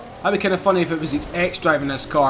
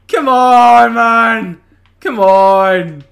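louder, groaning complaint; loud letdown; 3 semitones higher, 9 dB louder, much slower than usual. Real, not acted. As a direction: complain out loud at a dull prize. Disappointed by the reward car.

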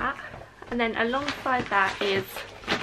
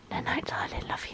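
soft goodnight whisper; tender whisper; 10 dB quieter than usual. Real, not acted. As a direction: whisper it gently as he drifts off. Saying goodnight to sleepy child.

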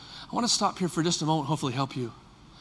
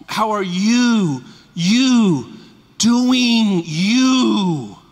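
slow, louder heartfelt call; called out warmly; 3 semitones higher, 8 dB louder, much slower than usual. Shown as a demonstration. Pastor calling out to members he misses. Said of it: "Let me give you my voice".

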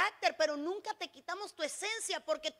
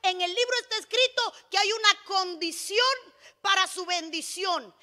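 loud, emphatic sermon delivery; full-force preaching; 2 semitones higher, 6 dB louder than usual. Real, not acted. Preacher declaring Samson's condition to the church, full-force preaching. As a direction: proclaim it loudly to the congregation.

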